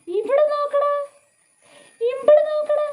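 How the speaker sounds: loud high motherese; excited coaxing call. Real, not acted.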